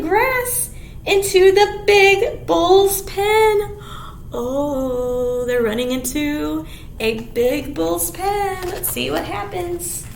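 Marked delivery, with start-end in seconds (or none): none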